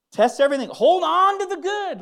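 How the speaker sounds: high, loud preaching cry; full-voiced exhortation; preaching shout. Real, not acted. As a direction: lift it and call it out to the room. Preacher building to climax.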